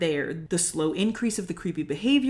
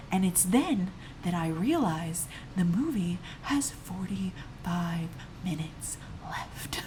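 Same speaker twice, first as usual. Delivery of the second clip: hushed, slowing to a crawl; stunned whisper; 8 dB quieter, slower than usual. Real, not acted. Storyteller realising how much movie is left, stunned whisper. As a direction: drop into a whisper for the dreadful reveal.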